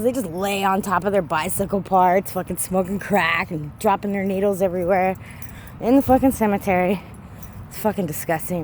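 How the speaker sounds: usual speaking voice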